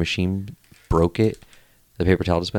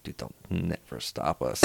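put-on slow, very soft drunk confidence; hushed scheming; 4 semitones lower, 13 dB quieter, slower than usual. Drunk thief to his accomplice, hushed scheming. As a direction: murmur it slowly, like a secret boast.

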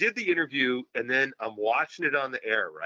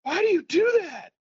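put-on much higher, loud protesting child; coughing kid's outcry; 5 dB louder than usual. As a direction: yell it after a coughing fit. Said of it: "Coughing up a lung".

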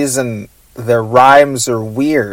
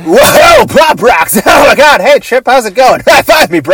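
excited shout; 9 semitones higher, 5 dB louder than usual. Pretend. Goofy character greets Chip.

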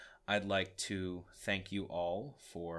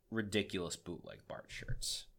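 usual speaking voice

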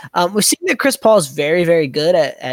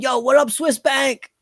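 higher-pitched imitation of players yelling; hammed-up holler, pitched up; mock yelling; 8 semitones higher than usual. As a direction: call it out like a teammate across the room. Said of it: "the Rockets are going around yelling".

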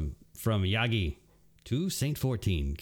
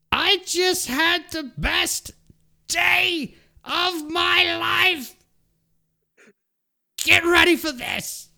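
much higher, louder, slow and thrilled; excited Titan; 7 dB louder, slower than usual. Acted. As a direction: brag about it like a gleeful warrior. Reads a Titan's gleeful lore report.

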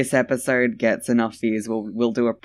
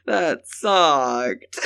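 normal talking voice

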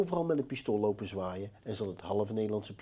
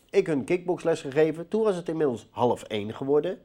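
ordinary talk, nothing marked

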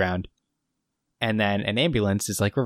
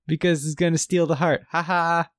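higher, hammed-up villain aside with a mock laugh; a light mock-evil gloat; sinister vampire aside; 7 semitones higher than usual. Host voices Grandpa gloating at Steven, a lightly voiced mock villain.

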